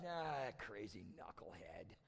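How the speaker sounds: low, slow shrug-it-off brother; easygoing dismissal. Acted. Older brother imagined shrugging off the news, easygoing dismissal. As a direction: brush it off slowly in a low voice. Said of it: "older brother would be like".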